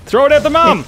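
shouting. Real, not acted. Excited shout at game.

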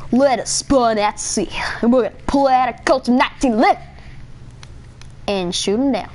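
normal talking voice

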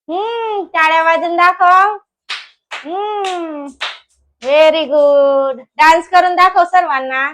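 high, stretched sing-song praise. Real, not acted. Mother praising toddler and asking for a dance, encouraging sing-song. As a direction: coax a little one to perform, drawing words out.

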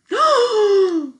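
sharp high gasp; surprised gasp. Acted. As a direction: gasp in shock. Reacting to monkey picture.

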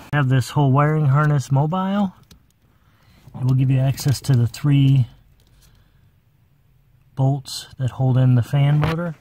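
normal talking voice

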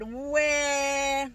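put-on high whiny baby cry. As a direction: play the baby wailing. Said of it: "baby cried".

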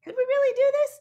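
excited high-pitched what-if; wondering question. Retold or imitated. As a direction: pitch it way up like a giddy first idea. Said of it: "the ones who first said, like".

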